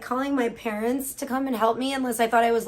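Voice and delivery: normal talking voice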